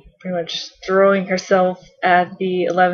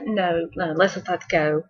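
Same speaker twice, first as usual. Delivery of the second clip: 1 quiet aside; 3 semitones lower, 7 dB quieter than usual. Retold or imitated. Doctor answers himself.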